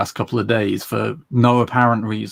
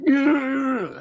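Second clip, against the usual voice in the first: awkward British politeness; 13 semitones higher than usual. Retold or imitated. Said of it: "I was being all British about it".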